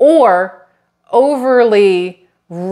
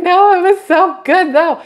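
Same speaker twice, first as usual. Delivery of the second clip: whiny mock lament; 10 semitones higher than usual. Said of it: "I was like".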